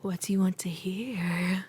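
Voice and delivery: slow, breath-only whisper; ASMR whisper. Pretend. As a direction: whisper it slowly, as if into a sleeper's ear. Improvising ASMR after listener's request.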